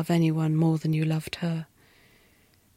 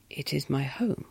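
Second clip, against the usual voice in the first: a lightly voiced low, soft madwoman; the wife's voice; 5 semitones lower, 8 dB quieter than usual. A narrator's reading. The wife explaining herself to the governess, low and soft. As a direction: speak low and softly, half lost.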